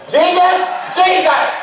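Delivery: high, loud desperate call for help; panicked calling. Retold or imitated. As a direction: cry out for Jesus in panic. Panicked disciples calling for Jesus.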